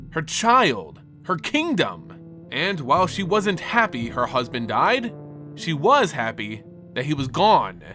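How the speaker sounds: plain reading voice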